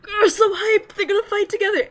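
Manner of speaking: high and loud, bursting with excitement; hyped fangirl. Retold or imitated. Fan reading the new chapter, bursting with hype. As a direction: squeal it like the best news ever. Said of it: "I'm like".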